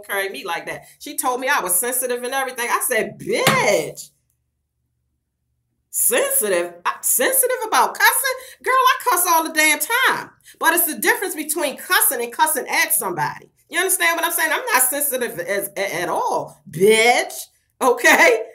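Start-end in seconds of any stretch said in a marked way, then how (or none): 3.2-10.3 incredulous comeback. Retold or imitated. Retold comeback to a blocker. 16.7-18.4 loud retort. Retold or imitated. Ranting at the blocker.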